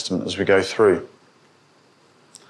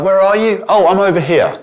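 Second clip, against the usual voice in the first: high, raised calling-out voice; casual locating call; 8 semitones higher, 6 dB louder than usual. Acted. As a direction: call across the room to say where you are. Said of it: "not just a geographical sense of".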